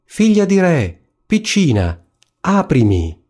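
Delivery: high, sing-out storybook call, falling away. A narrator's reading. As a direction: call through a door as the frog. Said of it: "bussò alla porta e gridò". (richiamo acuto del ranocchio)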